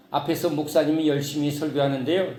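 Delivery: usual speaking voice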